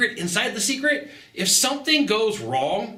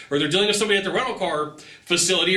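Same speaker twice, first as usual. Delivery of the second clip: usual speaking voice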